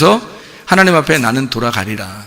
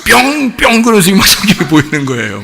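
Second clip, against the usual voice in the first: excited; 3 semitones higher, 3 dB louder than usual. Real, not acted. Excited recounting of revelation.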